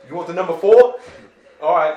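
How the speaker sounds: loud, high Brooklyn counter guy; Brooklyn accent. Pretend. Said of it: "We do a Brooklyn accent".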